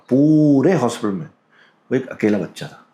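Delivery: ordinary talk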